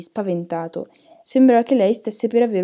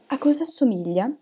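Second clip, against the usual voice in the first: usual speaking voice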